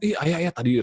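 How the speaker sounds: high-pitched mock wifely chatter; chatty wife. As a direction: launch into a long story for Ayah. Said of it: "wah, panjang banget gitu ya".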